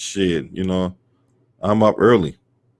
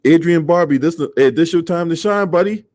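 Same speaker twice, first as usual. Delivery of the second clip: raised pitch, pumped up; hype shout-out; 7 semitones higher than usual. Real, not acted. Greeting a viewer by name, high and hyped.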